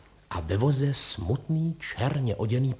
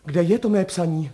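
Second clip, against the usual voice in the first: urgent, raised-voice question; calling out; 5 semitones higher, 5 dB louder than usual. A narrator's reading. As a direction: cry out for the missing letter. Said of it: "zvolal pan šofér Frantík".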